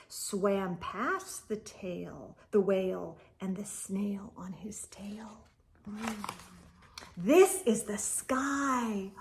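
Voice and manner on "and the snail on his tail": fading whisper; whispered close. A narrator's reading. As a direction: let it trail off softly. Hushed ending line.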